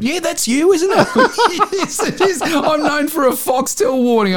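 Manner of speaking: high, laughing squeal of recognition; excited friend. Retold or imitated. Daughter's friend recognising the Foxtel voice, laughing and excited. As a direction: gush like a starstruck teen friend.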